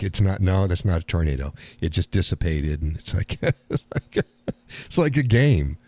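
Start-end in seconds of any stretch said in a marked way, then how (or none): none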